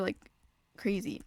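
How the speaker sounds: usual speaking voice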